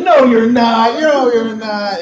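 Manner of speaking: high, loud, drawn-out disbelief; mock dismissal. Stranger rejecting the Idris claim, high mock dismissal.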